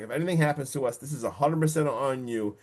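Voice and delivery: ordinary talk, nothing marked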